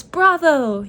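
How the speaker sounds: Mole cheering the magician; yelling bravo. A narrator's reading. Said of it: "yelled Mole".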